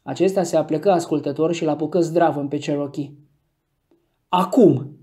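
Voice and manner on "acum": call out. A narrator's reading. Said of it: "anunță Scott".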